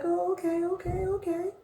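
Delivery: high, pleased and surprised; flattered okay. Retold or imitated. Woman flattered by fast-moving guy, high coo. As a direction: coo it, caught off guard.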